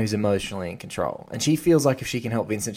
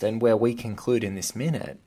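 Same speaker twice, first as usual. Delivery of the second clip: ordinary delivery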